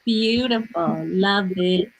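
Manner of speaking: normal talking voice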